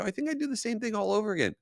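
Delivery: quick, squeaky mock reasoning; comically high, hurried inner voice; high-pitched self-justifying. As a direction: rattle it off way up high.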